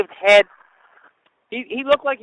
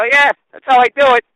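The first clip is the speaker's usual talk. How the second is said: smart-aleck; 4 semitones higher, 9 dB louder than usual. Retold or imitated. Smart-aleck reply to the cop.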